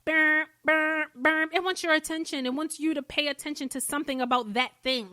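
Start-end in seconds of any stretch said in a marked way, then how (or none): 0.0-1.5 playful imitation of an alarm ringing, a bit higher and louder; mock alarm buzz. Podcaster imitating an alarm going off in your life, playful buzzing. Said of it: "it's like a little alarm going off".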